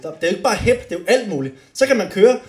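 ordinary delivery

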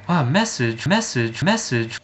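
lightly imitated voicemail woman, word repeated mechanically; mock a recorded voice stuck on one word; mocking plastic voice. Complaining how often the voicemail repeats a word, plastic voice. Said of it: "in that voice".

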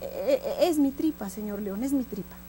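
soft stammering excuse; timid, scared donkey. Acted. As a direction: stumble into it softly, nervous before the lion. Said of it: "pero de miedo".

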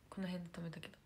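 ordinary delivery

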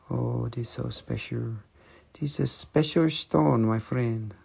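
soft, hushed ASMR sales pitch; whisper-soft to sell the stone. Acted. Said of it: "A S M R voice of, from China".

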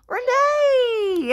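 gasp, then high squeal; delighted greeting. Pretend. Doll sees a friend is back, excited high squeal. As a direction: squeal the name with play-acted delight.

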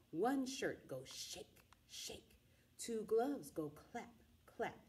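ordinary delivery, nothing marked

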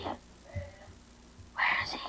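slow tense whisper. Real, not acted. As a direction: whisper it slowly, afraid. Tense search, repeated question.

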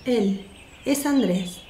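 ordinary talk

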